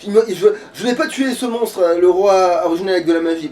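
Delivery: ordinary talk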